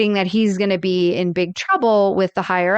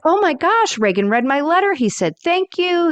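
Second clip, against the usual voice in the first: high, excited mock squeal; quoting Rose's excitement; 9 semitones higher than usual. Host retelling Rose answering Reagan's call, mock excited. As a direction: gush like a thrilled, naive woman. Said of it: "she's like".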